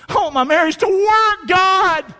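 loud, high-pitched desperate plea; passionate prayer. Acted. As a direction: shout it up to God with full force. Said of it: "having that kind of passion".

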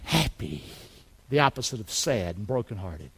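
very low and soft; solemn drop. Real, not acted. Preacher contrasts merry with brokenhearted, low and subdued. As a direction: let the voice sink right down.

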